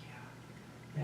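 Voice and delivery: just breathe the word out, worn out; weary whisper. Retold or imitated. Too tired to get up.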